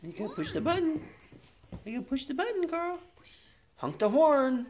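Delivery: put-on high coaxing voice for a baby; high baby talk. Coaxing baby to push button.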